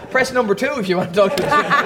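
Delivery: giggling automated menu read; a laughing call-centre recording; phone menu voice. Retold or imitated. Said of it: "and your liver would go".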